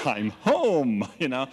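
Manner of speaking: loud, high, delighted; gleefully excited. Retold or imitated. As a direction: announce you've found your people. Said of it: "I was excited".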